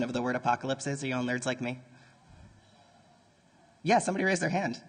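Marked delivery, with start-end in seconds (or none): none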